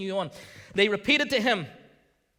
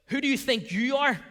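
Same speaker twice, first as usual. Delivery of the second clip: accusing voice; 6 semitones higher, 4 dB louder than usual. Acted. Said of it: "sometimes that voice".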